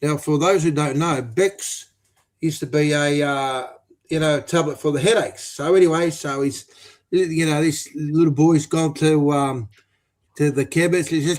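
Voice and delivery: usual speaking voice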